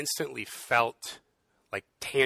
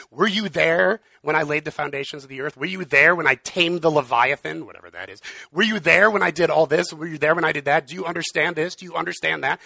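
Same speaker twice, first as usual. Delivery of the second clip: louder, booming questions from God; God's tirade; 3 semitones higher, 7 dB louder than usual. Retold or imitated. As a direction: thunder the questions down at Job. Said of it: "goes on a tirade".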